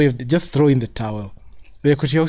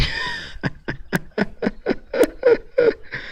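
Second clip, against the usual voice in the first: laugh; 5 dB quieter than usual. Real, not acted. Laughing at team's bad stats.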